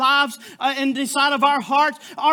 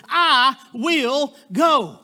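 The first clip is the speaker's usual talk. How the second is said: each word drawn out and certain; slow, firm "I will go"; 3 dB louder, much slower than usual. Retold or imitated. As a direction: say it slowly, with no doubt at all. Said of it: "without hesitation".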